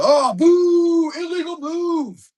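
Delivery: high, loud outraged shout; booing crowd. Retold or imitated. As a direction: boo the ref like an angry fan. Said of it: "hear the booster section go".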